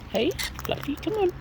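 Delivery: high coaxing call; sing-song pet call. Real, not acted. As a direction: call the bird over up high. Calling a bird.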